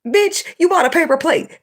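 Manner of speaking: high incredulous scoff; incredulous retort. Real, not acted. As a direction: shoot back in disbelief.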